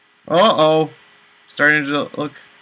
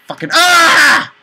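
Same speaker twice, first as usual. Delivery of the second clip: frustrated scream; 12 semitones higher, 6 dB louder, slower than usual. Real, not acted. Frustrated at failing the fight.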